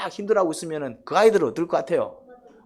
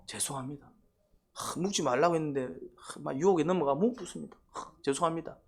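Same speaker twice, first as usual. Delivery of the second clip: soft, sighing contrite voice; humble apology; 8 dB quieter than usual. Retold or imitated. Adam confessing softly to God, contrite. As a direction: apologize meekly with sighs. Adam's proper humble answer.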